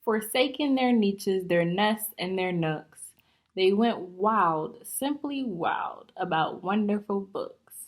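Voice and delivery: lively, bouncy rhyming storybook reading; story read-aloud. Acted. Teacher reading a rhyming book to young students, lively. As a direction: read it out to the class, full of life.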